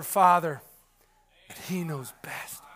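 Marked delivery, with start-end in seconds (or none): 1.5-2.6 breathy whisper, softer and lower; hushed whisper. Real, not acted. Preacher to congregation about trusting the Father, whispered. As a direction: lean in and whisper it like a secret.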